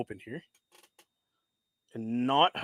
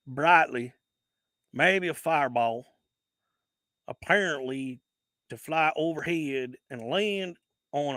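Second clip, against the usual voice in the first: Southern accent. Shown as a demonstration. Rereading the monster report to viewers in a put-on Southern accent. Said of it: "say that in the best Southern accent".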